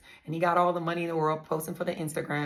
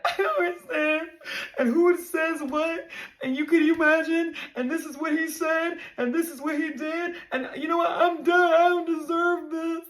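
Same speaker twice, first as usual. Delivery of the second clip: high-pitched, loud, crying outburst, replaying it; reliving a sobbing meltdown, voice pitched way up; tearful breakdown; 10 semitones higher, 5 dB louder than usual. Retelling a TikTok viewer her crying breakdown, high and loud. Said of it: "I literally break the fuck down on TikTok".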